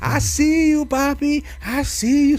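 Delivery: high teasing falsetto; imitating drag host singling him out. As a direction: camp diva calling out a guy in the crowd. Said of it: "she's like".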